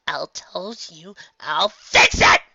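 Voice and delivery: slow, from whisper to loud screech. A narrator's reading. Filly screams at Nightmare Moon, whisper rising to screech. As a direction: snap from hush into a scream. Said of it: "she screeched".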